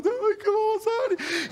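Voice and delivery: high-pitched imitation of a weeping woman; mock tearful woman. Pastor plays the woman standing up, mock tearful squeal. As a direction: squeal it as if crying, hammed-up. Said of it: "llorando la mujer".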